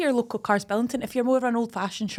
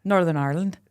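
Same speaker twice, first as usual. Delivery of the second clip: Northern Irish accent; 3 semitones lower than usual. Shown as a demonstration. Co-host retrying after being called posh, Northern Irish accent. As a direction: slightly lower second go at Northern Irish. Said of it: "Was that Northern Irish accent".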